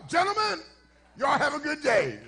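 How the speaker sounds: bow out with mock courtesy; polite exit. Pastor excusing himself from the barbershop, overly polite. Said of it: "I politely".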